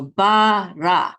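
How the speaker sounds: "ba, ra" as two long separate syllables. Shown as a demonstration. Teacher joins syllables for learners, slow and separated. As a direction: sound out "ba", then "ra", slowly. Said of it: "this says ra".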